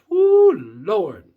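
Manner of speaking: loud, high joyful woo; excited whoop. Real, not acted. Vlogger praising Avatar to viewers, loud excited whoop. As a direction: let it burst out of you. Raving about the movie.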